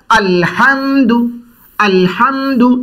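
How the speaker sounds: अलहम्दु with a breathy हा drawn out slowly; slow tajweed model; slow correct hamdu. Shown as a demonstration. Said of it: "जैसे हमने इसको बताया".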